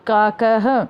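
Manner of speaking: काकः with long "aa" and final "ha"; model pronunciation. Shown as a demonstration. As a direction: sound काकः as kaa-ka-ha. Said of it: "उनको भी उच्चारण करना है".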